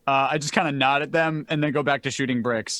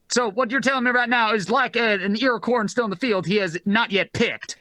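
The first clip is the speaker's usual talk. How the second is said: higher-pitched CB-radio chatter; funny radio-protocol voice; 4 semitones higher than usual. Acted. Asking who the good boy will marry, funny radio voice. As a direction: chat over the comm in radio slang. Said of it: "if I sound a bit funny".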